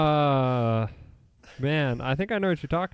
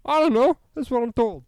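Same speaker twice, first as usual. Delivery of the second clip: high-pitched defensive; 10 semitones higher, 7 dB louder than usual. Real, not acted.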